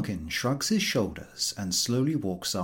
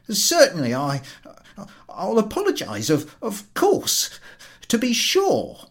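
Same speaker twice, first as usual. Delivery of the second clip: flustered stammer; 12 semitones higher, 6 dB louder than usual. Acted. Collector to the gentlemen, flustered. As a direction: promise anything in a fluster. Podyakin still panicked.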